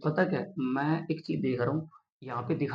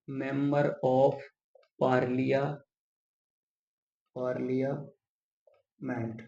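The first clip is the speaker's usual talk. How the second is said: very slow, syllables drawn apart; dictating while writing; 5 dB quieter, much slower than usual. Shown as a demonstration. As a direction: stretch each word as you write it. Writing the term out while saying it. (लिखते हुए बहुत धीरे, टुकड़ों में)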